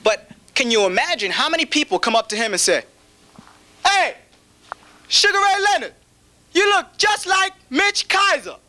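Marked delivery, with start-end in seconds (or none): 3.9-8.6 fan's mock greeting. Fan mistaking him for celebrity.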